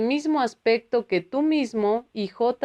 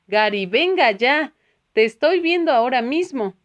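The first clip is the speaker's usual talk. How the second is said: louder, exasperated objection; incredulous protest; 4 semitones higher, 5 dB louder than usual. A narrator's reading. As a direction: come on, I can see you. Gary protests Tomás isn't here. (protesta incrédula, más fuerte)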